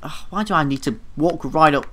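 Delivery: ordinary talk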